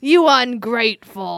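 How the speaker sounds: loud, hammed-up scolding; mock outrage. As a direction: berate the orphan in play. Acted master scolding begging orphan.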